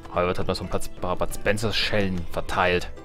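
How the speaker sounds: normal talking voice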